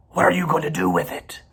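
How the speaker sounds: fast, hissed question; rat whisper. A narrator's reading. Templeton presses the goose in a quick whisper. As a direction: whisper it greedily. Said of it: "continued Templeton".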